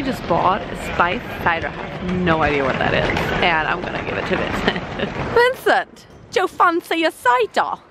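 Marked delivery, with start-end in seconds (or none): 5.3-7.8 high-pitched put-on British accent; mock British accent. Offering Vincent a spiced cider, mock British accent. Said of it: "I've been practicing my British accent".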